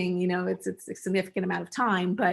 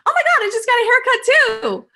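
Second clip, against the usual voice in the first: gushing friend; 9 dB louder than usual. Retold or imitated. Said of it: "your friend is like".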